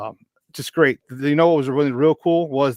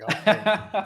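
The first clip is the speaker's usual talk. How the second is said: a high, laughing okay; 7 semitones higher than usual. Retold or imitated. Shrugging off teammates' teasing about his old Italian, with a laugh. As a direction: laugh it off, higher.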